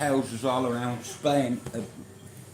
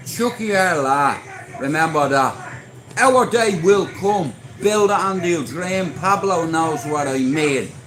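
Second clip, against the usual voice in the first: loud, raised-pitch rallying cry; rallying slogans; 5 semitones higher, 8 dB louder than usual. Real, not acted. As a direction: call it out like a slogan to your followers. Calling out slogans to followers.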